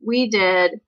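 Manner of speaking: usual speaking voice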